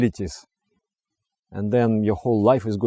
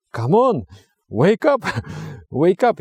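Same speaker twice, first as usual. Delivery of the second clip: urging yourself awake; 7 semitones higher than usual. Retold or imitated. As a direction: wake yourself up with a laughing push. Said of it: "You feel like".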